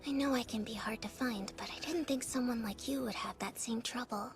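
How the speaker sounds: breathy little whisper. Acted. Child whispering in game.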